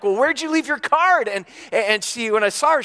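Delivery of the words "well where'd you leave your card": pitched high, annoyed questioning; a husband's exasperated accusation; mad. Retold or imitated. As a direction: demand it of her, irritated. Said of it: "I'm mad".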